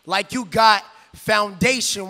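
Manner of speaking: ordinary talk, nothing marked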